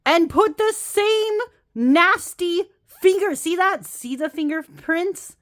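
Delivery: high-pitched indignant rant, slow and emphatic; exasperated high complaint with a laugh; mad rant. Real, not acted. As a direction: vent real outrage at the cardstock. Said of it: "I'm so mad".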